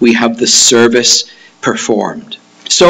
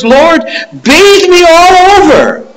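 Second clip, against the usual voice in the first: very high, loud, fervent cry; wildly eager outburst; over-eager Peter; 5 dB louder than usual. Acted. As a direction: cry out all in, stretching the plea. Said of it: "He's either one extreme or the other".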